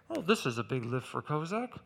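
low, soft coach impression; understated coach commenting on the weight; doubtful coach. Coach eyeing the heavy bar, doubtful low voice. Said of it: "my coach looks at the weight and goes".

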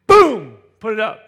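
explosive boom. Acted. Lifter shoving the heavy bar up, explosive boom. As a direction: burst out with the effort of the push.

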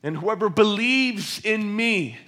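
loud, high proclamation; crying out loudly. Acted. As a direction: project it boldly to a whole crowd. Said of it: "in a loud voice".

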